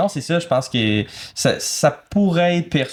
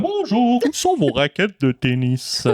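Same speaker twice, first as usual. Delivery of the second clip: high, theatrical gentleman customer; casting voice; 8 semitones higher than usual. Acted. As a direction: greet the shop like a grand character. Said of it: "j'avais cette voix de casting-là". (voix de casting, théâtrale)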